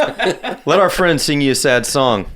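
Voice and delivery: slow, giggly pretend pitch; laughing mock line. Friend jokes to the dancer, laughing slowly. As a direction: laugh through the joke. Joking strip club scene.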